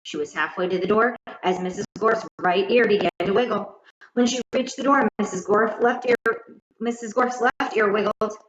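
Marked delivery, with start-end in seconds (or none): none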